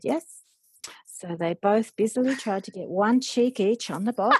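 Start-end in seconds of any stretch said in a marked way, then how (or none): 0.0-0.4 put-on much higher little girl yes; tiny toddler yes. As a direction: chirp it like a two-year-old. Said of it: "And she said".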